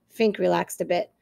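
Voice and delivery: plain reading voice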